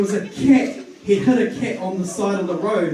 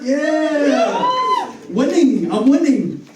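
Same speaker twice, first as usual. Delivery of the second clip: mock triumph; 6 semitones higher, 4 dB louder, much slower than usual. Mock triumph over gas station job.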